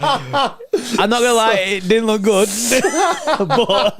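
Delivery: high, loud laughing through the words; slow, giggling disbelief. Real, not acted. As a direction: tease a friend while cracking up. Laughing at partner's gaffe.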